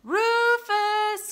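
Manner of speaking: high, loud, drawn-out name; long-held call through the house. A narrator's reading. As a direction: holler for a hiding dog. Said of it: "called Sam".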